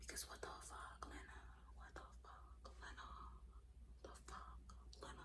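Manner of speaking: breathy exasperated whisper; stunned whispered disbelief. Real, not acted. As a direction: hiss it under your breath in disbelief. Exasperated aside to absent poster.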